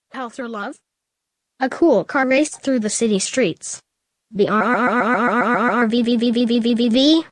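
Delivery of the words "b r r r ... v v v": a rolling vocal brrr turning to a buzzing vvv; engine revving. A narrator's reading. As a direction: imitate a car engine roaring down the street. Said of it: "said the car".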